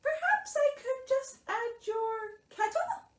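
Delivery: very high, tentative mouse; helpful Mouse. A narrator's reading. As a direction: suggest it eagerly in a tiny voice. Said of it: "said Mouse helpfully".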